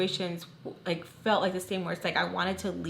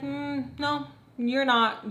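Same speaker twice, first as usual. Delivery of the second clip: higher, drawn-out mock refusal; dismissive refusal; 6 semitones higher, much slower than usual. Housemate forbidding the teller's date, dismissive. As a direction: imitate a housemate slowly shutting the idea down.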